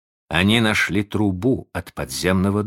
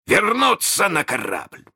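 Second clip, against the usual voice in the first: higher-pitched villain's order through the teeth; slowly through clenched teeth; 10 semitones higher than usual. A narrator's reading. Hook ordering a return to the ship, through clenched teeth. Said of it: "произнёс Крюк медленно сквозь зубы".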